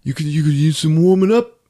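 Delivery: high-pitched, put-on nice-guy voice; Anthony LaPaglia, nice. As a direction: lay on the friendliness in a high mock voice. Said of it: "he's all nice to her".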